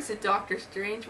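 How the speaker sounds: usual speaking voice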